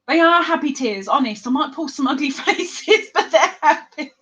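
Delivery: high, laughing through the words; laughing reassurance. Real, not acted. Reassuring chat friends the tears are happy, laughing. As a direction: insist it while breaking into laughter.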